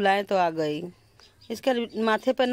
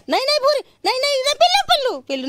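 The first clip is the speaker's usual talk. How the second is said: much higher, louder shout to stop the puppy; sudden shout; 8 dB louder, much faster than usual. Real, not acted. Owner yelling at the puppy running off, high shout. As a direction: yell sharply to stop her.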